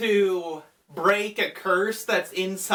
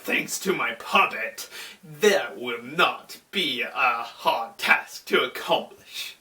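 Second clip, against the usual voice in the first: low theatrical villain voice; Anubis; 4 semitones lower than usual. Retold or imitated. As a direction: greet an old foe with low, mock menace. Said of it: "he's like".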